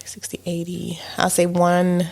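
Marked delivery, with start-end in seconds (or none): 0.1-1.0 whispered guesses; whispering. Real, not acted. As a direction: whisper the numbers to yourself. Guessing under her breath.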